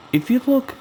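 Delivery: usual speaking voice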